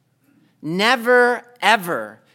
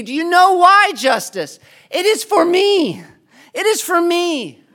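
impassioned plea; 5 semitones higher, 5 dB louder than usual. Acted. Preacher showing Justice the crucified Christ, loud high plea. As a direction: drag Justice to the cross with your voice.

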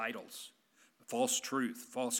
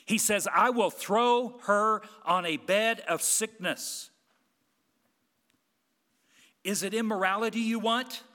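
loud, driving sermon delivery; preaching at full force; 2 semitones higher, 5 dB louder than usual. Acted. Pastor warning the congregation of God's judgment, preaching at full force. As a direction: hammer the warnings home to the pews.

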